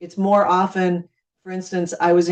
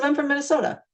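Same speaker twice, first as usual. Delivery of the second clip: cheerful friendly; 9 semitones higher, faster than usual. Retold or imitated. Friendly bar chat with stranger.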